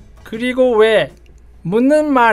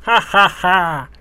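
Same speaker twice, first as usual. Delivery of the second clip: dark lord laugh; much slower than usual. Acted. Demon king laughs off the threat.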